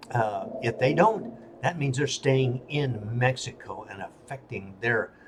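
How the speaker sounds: ordinary delivery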